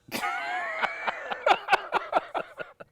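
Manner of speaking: long high-pitched cackle; real laughing fit. Real, not acted. Host cracking up at co-host's Xbox One answer, real laughter. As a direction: burst out in loud, high laughter.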